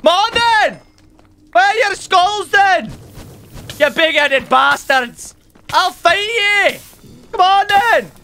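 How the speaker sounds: loud high battle taunts; shouting taunts. Real, not acted. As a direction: yell a challenge at the enemies. Taunting skeletons in a fight.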